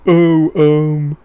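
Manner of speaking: loud put-on clueless voice; hammed-up oblivious official; mock coordinator impression. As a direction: do a caricature of a dim bureaucrat. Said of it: "it's a pretty spot-on impression".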